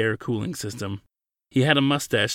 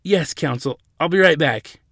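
high, hurried-to-obey assent; anxiously comply and excuse yourself; nervous; 5 semitones higher, 7 dB louder than usual. A narrator's reading. Attendant scurrying off to fetch a superior, nervous. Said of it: "he said nervously".

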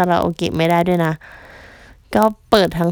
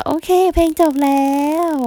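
much higher, falsely cheerful announcement; mock breezy exit; 8 semitones higher than usual. Singer on stage bluffing after the music cuts, mock cheerful. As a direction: cover the awkward moment with bright pretend calm. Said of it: "เราก็".

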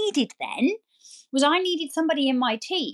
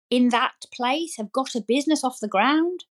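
ordinary talk, nothing marked

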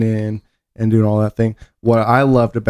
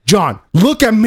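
high, pushy command; mock aggressive command; 11 semitones higher, 3 dB louder than usual. Bullying John out of his hiccups, high mock command. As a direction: demand his attention forcefully.

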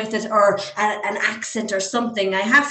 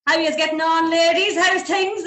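lads' Irish greeting; 7 semitones higher than usual. Retold or imitated. Lads greeting in Irish accent.

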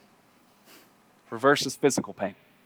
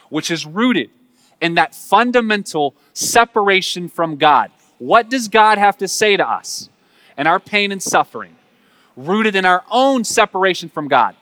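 louder, higher impassioned preaching; preaching at full force; 4 semitones higher, 8 dB louder than usual. Acted. Preacher pressing the congregation about pain, impassioned. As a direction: drive the point home hard.

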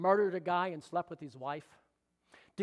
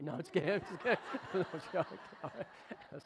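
laughing through the words, much softer; giggling it out after the joke; 7 dB quieter than usual. Real, not acted. Pastor teasing Jeff, laughing at his own joke.

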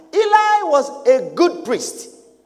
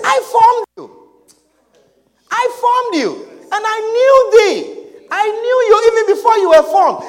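higher, louder, firm God insisting; 6 semitones higher, 5 dB louder than usual. Retold or imitated. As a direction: declare it as God reassuring his prophet. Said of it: "And he said".

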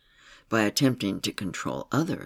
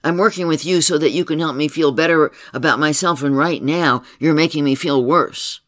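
louder, higher, heated complaint; angry accusation; 5 semitones higher, 9 dB louder than usual. Retold or imitated. Client accusing her therapist of making things worse, heated and loud. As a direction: let the grievance boil over.

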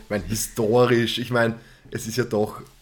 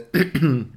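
kurzes Räuspern, Stimme weg; 4 semitones higher, 4 dB louder than usual. Real, not acted. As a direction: sich hörbar räuspern. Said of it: "meine Stimme versagt". (räuspert sich)

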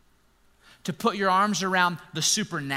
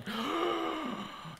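mock gasp; 12 semitones higher, 8 dB quieter than usual. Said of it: "all of his buddies were like".

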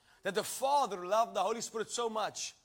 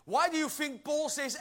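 normal talking voice

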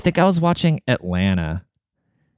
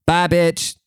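sassy send-off; 7 semitones higher than usual. Pretend. Mock advice to leave wife.

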